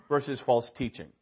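ordinary talk

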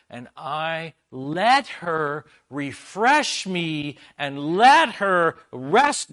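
rising to loud, high preaching at full force; a preacher driving home that it was never about the tea. Acted. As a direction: drive the point home from the pulpit. Preaches the point.

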